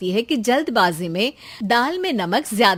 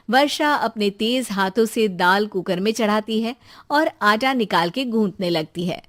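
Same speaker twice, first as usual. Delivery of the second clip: plain reading voice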